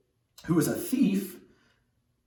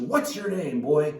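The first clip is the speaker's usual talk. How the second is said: raised-pitch mock angry demand; 2 semitones higher, 4 dB louder, slower than usual. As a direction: confront the boy, voice lifted in acted anger. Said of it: "gets angry".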